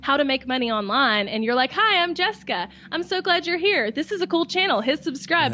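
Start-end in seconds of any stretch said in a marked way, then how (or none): 1.7-5.5 high, chirpy vlog greeting; cheesy YouTuber intro voice. Retold or imitated. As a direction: play the bland channel host. Mocking generic YouTube intro.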